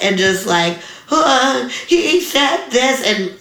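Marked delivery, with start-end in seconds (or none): 1.1-3.0 retold crying.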